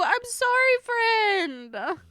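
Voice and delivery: high, whiny fake sob on sorry; a hammed-up tearful sorry; mock crying apology. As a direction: pretend to weep as you apologise.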